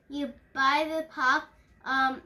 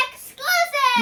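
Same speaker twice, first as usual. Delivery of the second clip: shouted, much higher and louder; loud repeat; 13 semitones higher, 11 dB louder, slower than usual. Real, not acted. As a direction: say it loud so they hear. Repeating loudly to mishearing listener.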